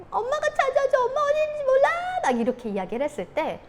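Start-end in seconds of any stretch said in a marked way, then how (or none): 0.1-1.9 put-on very high-pitched child's whine; mock whining child. Child to mom over a lost toy, mock whining. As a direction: imitate a kid pestering mom, shrieky.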